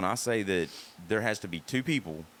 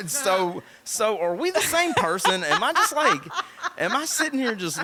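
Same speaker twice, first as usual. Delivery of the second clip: laughing; 12 semitones higher, 6 dB louder than usual. Real, not acted. Laughing at being called incel.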